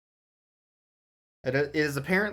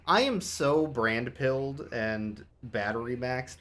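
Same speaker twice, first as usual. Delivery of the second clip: slow mock-solemn; slower than usual. Real, not acted. Joking about brand placements.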